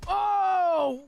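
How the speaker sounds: sharp pained yelp; hit hard crying out. Acted. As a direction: cry out as if punched. Ghost punched in face.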